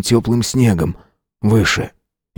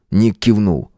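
usual speaking voice

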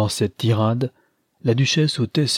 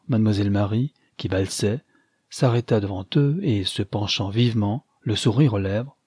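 usual speaking voice

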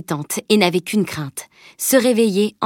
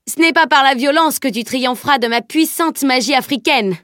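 higher, mock-heroic declamation; grandiloquence moqueuse; 7 semitones higher than usual. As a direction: proclaim it with playful bombast. Boy mock-boasts of his magic. (fanfaronnade théâtrale pour rire)

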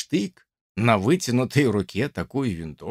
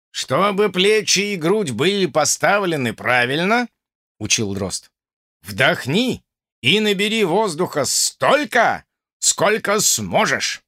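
raised, commanding drill instructor; stern instructor; 5 semitones higher than usual. Acted. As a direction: bark out the lesson like an officer. Drozd instructing cadets, raised voice.

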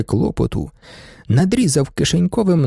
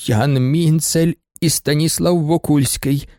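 ordinary delivery, nothing marked

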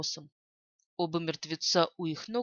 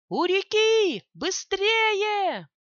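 urgent high shout; lookout shouting; 10 semitones higher, 7 dB louder, much slower than usual. A narrator's reading. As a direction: yell the warning from afar. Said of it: "долетел крик наблюдателя".

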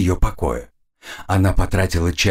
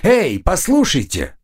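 raised, higher call; 7 semitones higher than usual. A narrator's reading. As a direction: shout to get attention. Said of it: "крикнула она".

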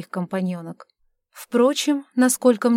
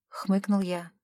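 ordinary delivery, nothing marked

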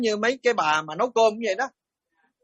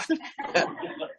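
short breathy laugh; a quick silent chuckle; soft airy laugh at his own joke; unvoiced laugh; 8 semitones higher, 8 dB quieter than usual. Real, not acted.

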